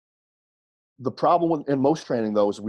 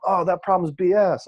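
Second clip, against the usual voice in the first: confident wrestler; 5 semitones higher than usual. Retold or imitated.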